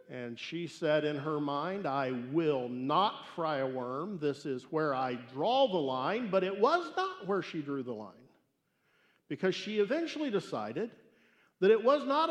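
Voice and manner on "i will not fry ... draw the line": slow, deliberate refusal; firm resolve. Acted. Mother vowing to herself not to fry the worm, slow and firm. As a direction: lay down the law slowly.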